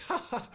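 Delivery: high-pitched mock laughter; comic high "ha ha"; imitated laugh. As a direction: mimic someone cracking up. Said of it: "So he starts laughing".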